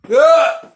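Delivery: sudden high yelp; loud high yelp of disbelief; startled yelp. Real, not acted. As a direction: yelp out in mock shock. Shocked outburst at the idea.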